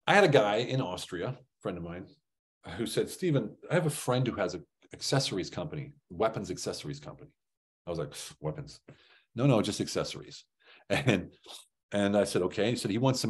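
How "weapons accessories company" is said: ordinary talk, nothing marked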